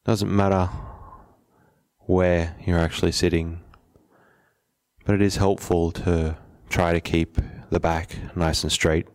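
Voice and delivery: unhurried meditation guide, long pauses between phrases; measured, settled meditation instruction; guided meditation. Acted. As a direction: calmly guide them to settle, leaving space after each phrase.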